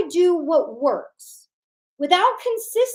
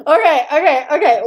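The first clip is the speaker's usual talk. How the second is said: laughing it off; 2 semitones higher, 9 dB louder than usual. Real, not acted. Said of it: "we needed that little laugh".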